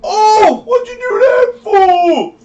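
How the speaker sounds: high, slow, loud yelp of pain; wounded townie yelling at his attacker; pained shout. Acted. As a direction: cry out like a wounded thug. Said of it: "is like".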